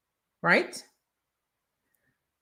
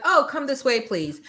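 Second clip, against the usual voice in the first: mock welcoming store clerk; 8 semitones higher than usual. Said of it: "It's like".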